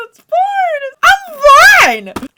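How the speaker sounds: very high, loud mock scream; shrieked reassurance. Insisting all is fine after breakdown, hammed-up shriek. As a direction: screech it as if losing all composure.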